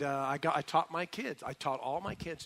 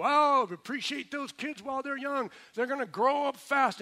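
higher, lightly voiced old folks; a hint of wistful old-timers giving advice; old people advising; 11 semitones higher, 8 dB louder than usual. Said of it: "see the old people go".

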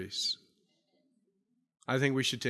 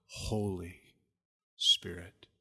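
"Holy" set apart, lower and slow, each word weighted; emphatic Holy Spirit; 10 semitones lower, 6 dB quieter, slower than usual. Shown as a demonstration. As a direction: say "Holy Spirit" like big capital letters. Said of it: "ought to be in big capital letters".

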